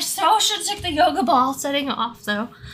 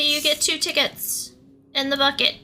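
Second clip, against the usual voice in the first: usual speaking voice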